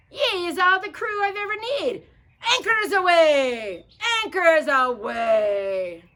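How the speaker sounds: loud, high joyful cheer; cheering captain. Acted. Pete cheering to his parrot, loud and high. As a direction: celebrate finding the crew. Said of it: "he cheered to the bird".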